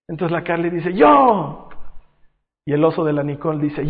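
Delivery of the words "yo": high, loud imitation of a child; eager child shout. Little girl answering dad's milk offer, eager shout. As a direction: shout it like a keen kid.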